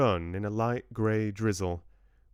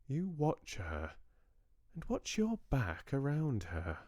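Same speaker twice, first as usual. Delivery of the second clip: obstinate warning; 6 semitones higher, 4 dB quieter than usual. Acted. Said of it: "said Georgia obstinately".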